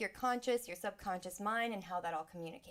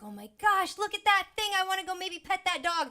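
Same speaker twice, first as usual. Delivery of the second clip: put-on higher and louder, a kid thrilled at a dog; excited kid; 11 semitones higher, 10 dB louder than usual. Child sees a dog across the street, thrilled and loud. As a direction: bubble over like a child spotting something cool.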